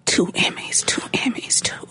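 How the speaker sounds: breathless awed whisper. Real, not acted. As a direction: whisper it in quiet awe. Awed aside about the Emmys.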